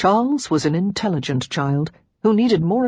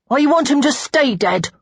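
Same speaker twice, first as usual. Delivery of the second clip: put-on high, loud child's voice; raised-pitch boy's declaration; small boy announcing; 8 semitones higher, 5 dB louder than usual. A narrator's reading. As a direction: blurt it out like a little boy. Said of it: "Charles announced".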